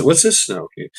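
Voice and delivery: normal talking voice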